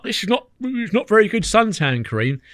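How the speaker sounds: high, slow, baffled complaint; puzzled mate. Retold or imitated. Wes rubbing on cream that won't sink in, baffled and high. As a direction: sound confused by the lotion.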